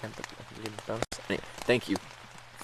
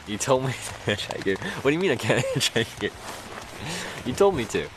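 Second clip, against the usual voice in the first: high, louder, laughing in disbelief; incredulous protest; 7 semitones higher, 3 dB louder than usual. Retold or imitated. Telling the cop he said to hitchhike, incredulous and laughing. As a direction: throw his own words back at him.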